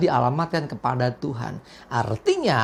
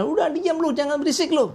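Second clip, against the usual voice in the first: high-pitched mock irritation; annoyed; 9 semitones higher than usual. Annoyed crowd hushing the noisy beggar, playfully imitated annoyance. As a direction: mimic an annoyed crowd, breaking into a laugh. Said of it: "orang-orang jadi jengkel".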